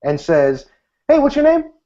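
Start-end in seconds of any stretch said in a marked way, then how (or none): 1.1-1.7 much higher, loud, chummy hey; random dude greeting. Retold or imitated. Random dude walks up to wedding guests, loud and chummy. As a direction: breeze up like a random friendly guy. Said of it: "some random dude walks up and says".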